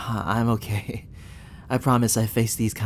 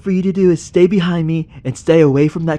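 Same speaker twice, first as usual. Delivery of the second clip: loud, higher, breathless orders under threat; urgent commands; 8 semitones higher, 10 dB louder than usual. Acted. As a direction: bark instructions before the monster strikes. Urgent orders facing a creature.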